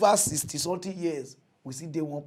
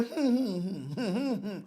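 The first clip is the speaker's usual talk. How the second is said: mock moaning; 4 semitones higher than usual. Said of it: "you go dey hear".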